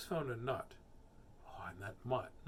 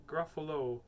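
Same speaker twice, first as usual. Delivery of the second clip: ordinary delivery, nothing marked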